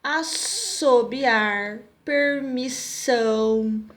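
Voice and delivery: drawn-out words with a clear S; stressed S sound. Shown as a demonstration. Teacher showing pupils how SS sounds, stressed S. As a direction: stretch the S in assobiar and permissão. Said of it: "tem som de S mesmo".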